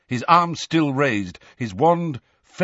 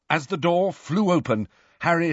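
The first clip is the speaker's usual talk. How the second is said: plain reading voice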